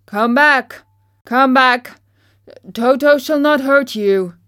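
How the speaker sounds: loud reassuring call. Acted. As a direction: call after fleeing mice. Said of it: "he called to the mice".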